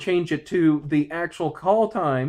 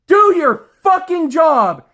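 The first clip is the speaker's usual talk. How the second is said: slow, high, even louder yell with a bitter laugh; louder furious shout; 8 semitones higher, 7 dB louder, slower than usual. Real, not acted. Ordering coworkers again, louder furious yell with a laugh. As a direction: push it past the limit, almost laughing in rage. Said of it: "angry as hell over this shit".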